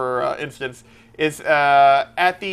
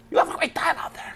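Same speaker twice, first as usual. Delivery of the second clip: Christopher Walken impression; 12 semitones higher, 5 dB quieter than usual. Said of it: "Christopher Walken".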